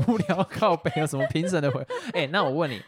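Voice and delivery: loud laughing words; laughing while talking. Real, not acted. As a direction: talk through loud laughter. Laughing at co-host's dry reply. (边大笑边说话)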